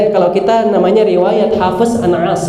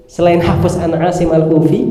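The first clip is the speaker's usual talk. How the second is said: usual speaking voice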